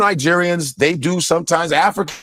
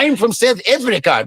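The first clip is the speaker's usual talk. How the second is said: put-on South African accent; 4 semitones higher than usual.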